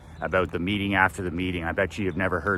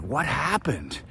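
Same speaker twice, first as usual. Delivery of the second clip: high, puzzled "what happened?"; baffled question; 7 semitones higher, 5 dB quieter, slower than usual. Retold or imitated. Fans asking about a fired coach, baffled and high. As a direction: ask it like you truly can't believe it. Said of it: "they're like".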